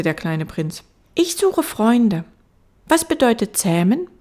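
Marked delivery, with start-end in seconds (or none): 1.1-4.1 put-on high, loud child voice; little prince. Little prince pressing the fox, high child voice. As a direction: press the question like a small boy.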